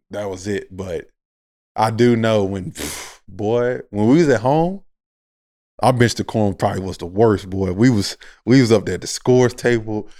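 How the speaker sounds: usual speaking voice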